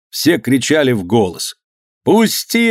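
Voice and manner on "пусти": high-pitched struggling yell. A narrator's reading. Usov struggles against men holding him, yelling. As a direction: yell to be let go. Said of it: "орал Усов".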